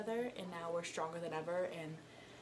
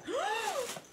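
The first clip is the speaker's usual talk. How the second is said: sharp gasp. Real, not acted. Discovering surprise in gift.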